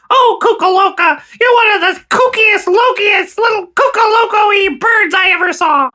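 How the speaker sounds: high squeaky cartoon mouse; Mickey Mouse voice. Mickey Mouse cheering up Goofy about the ice pick, high and squeaky. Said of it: "I'm Mickey Mouse".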